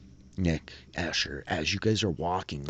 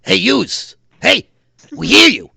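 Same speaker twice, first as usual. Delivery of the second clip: loud shout, much higher and louder; calling out; 15 dB louder than usual. Acted. Sewer dweller hails the strangers down the tunnel, shouting. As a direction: yell down the hall to be heard.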